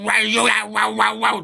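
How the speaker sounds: high, loud mimicked drunken ranting; drunk caller garble. Host imitating a drunk caller, loud garbled babble.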